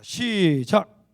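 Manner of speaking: very loud, drawn-out start signal; loud reading cue. Real, not acted. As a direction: launch the congregation's reading with a long, loud call. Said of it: "크게 읽겠습니다".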